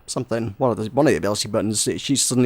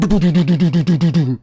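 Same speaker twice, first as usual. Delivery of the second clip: imitated turret gunfire; 3 semitones higher than usual. Turret kills runner.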